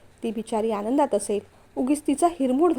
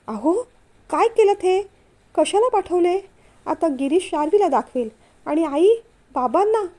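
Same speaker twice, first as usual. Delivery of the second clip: higher and louder, scolding in alarm; angry wife; 5 semitones higher, 3 dB louder than usual. A narrator's reading. Wife angrily scolding husband over sent photos, raised voice. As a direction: scold him for sending the photos. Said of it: "ती चिडली".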